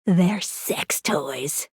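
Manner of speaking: slow, drawn-out whisper; very slow, low confidential whisper; hushed reveal. Acted. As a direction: whisper it like a naughty secret. Hushed reveal of a risqué secret.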